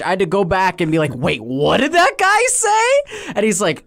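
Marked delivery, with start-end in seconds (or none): 1.1-3.3 high, slow mock incredulity; drawn-out high comic bewilderment; baffled disbelief. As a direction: exaggerate the double take, pitch soaring. Acts out his own baffled reaction.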